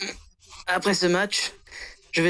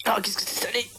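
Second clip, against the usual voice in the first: whispered; 4 semitones higher, 4 dB quieter than usual. Acted. Tasting the rice bowl.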